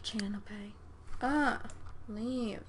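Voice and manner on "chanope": whisper. Real, not acted. Naming a Sim quietly.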